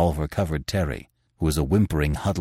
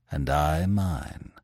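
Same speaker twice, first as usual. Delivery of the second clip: slow, soft, measured reply; quiet response; 3 semitones lower, 6 dB quieter, much slower than usual. A narrator's reading. Masked man returns the editor's promise, quietly. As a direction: answer quietly, taking your time. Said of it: "was the quiet response".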